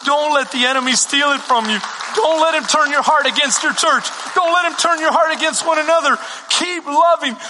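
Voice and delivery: preaching exhortation. Acted. Pastor urging the church to keep loving. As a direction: drive it home to the congregation.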